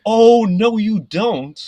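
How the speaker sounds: loud, high retort, re-enacted; indignant outburst. Worker hearing his helper abused from inside, indignant outburst.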